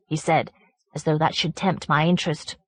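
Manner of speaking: plain reading voice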